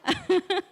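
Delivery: short high laugh; amused little burst of laughter. Real, not acted. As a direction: laugh at your own story. Laughs at her own shouting.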